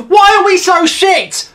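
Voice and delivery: loud despairing yell stressing why; angry shout. Real, not acted. Fan raging at England's play, loud despairing shout. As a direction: bellow it at the telly.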